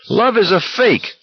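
high blaring rant. A narrator's reading. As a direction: blare it out. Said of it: "Olson was blaring".

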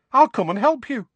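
a bright, high, loud cheery offer; brightly. A narrator's reading. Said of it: "said Tonks brightly".

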